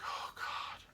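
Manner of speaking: mock-panicked whisper, very soft; whispered dread. Man retelling his run from the geese, whispered dread. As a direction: whisper it to yourself mid-escape.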